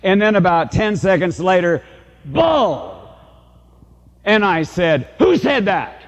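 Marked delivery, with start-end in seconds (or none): 2.2-3.1 heckling woman's call. Retold or imitated. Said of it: "A female voice saying that". 5.2-6.0 higher, sharp call into the hall; retold challenge. Speaker challenges heckler in hall.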